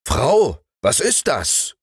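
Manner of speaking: high-pitched startled question; calling out, startled. A narrator's reading. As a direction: call sharply to the wife. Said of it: "rief der Mann". (erschrockener Ausruf)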